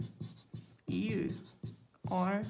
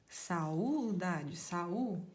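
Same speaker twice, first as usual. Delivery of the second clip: normal talking voice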